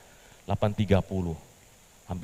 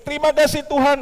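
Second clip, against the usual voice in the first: much higher, louder thanks; joyful praise; 10 dB louder than usual. Acted. Petrus thanking God after the prize call, overjoyed.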